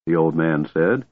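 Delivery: plain reading voice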